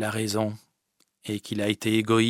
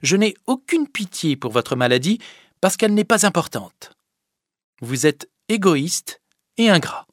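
higher, louder harsh reproach; scolding Fadette; 8 semitones higher, 6 dB louder than usual. Acted. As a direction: scold him without pity. (la fadette qui gronde, dure)

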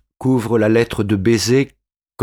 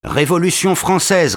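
higher, firm answer called back; defiant reply; 8 semitones higher than usual. A narrator's reading. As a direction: throw the answer back proudly. Insurgent answers the challenge. (un insurgé répond fièrement)

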